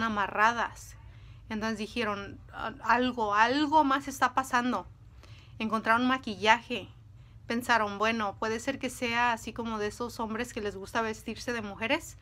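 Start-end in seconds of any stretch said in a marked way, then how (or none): none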